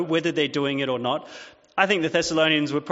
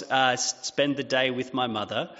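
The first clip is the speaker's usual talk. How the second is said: ordinary delivery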